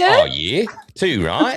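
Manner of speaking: high, rising Australian lilt; sing-song Australian accent. Shown as a demonstration. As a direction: swing up at the end, very Aussie. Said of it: "you go up at the end of every sentence".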